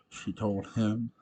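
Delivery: ordinary delivery, nothing marked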